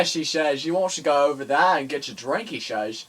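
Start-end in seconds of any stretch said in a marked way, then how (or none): 0.6-2.5 loud, broad mock Worcestershire voice; Worcestershire accent. Telling someone to get a drink, mock Worcestershire accent. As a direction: ham up a Worcestershire local. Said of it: "done in a Worcestershire accent".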